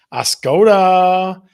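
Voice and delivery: big radio-host hype; excited hype. Real, not acted. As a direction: shout the town name like a winner. Hyping a favourite town on air.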